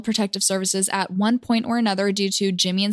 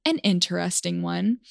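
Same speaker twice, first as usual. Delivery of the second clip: ordinary delivery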